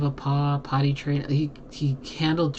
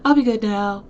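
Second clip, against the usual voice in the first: high, louder mock-contrite dog; apologetic dog voice; 8 semitones higher, 5 dB louder than usual. Owner voicing the dog that was biting, mock-apologetic. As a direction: imitate a dog promising, pitched well up. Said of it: "he starts licking, like".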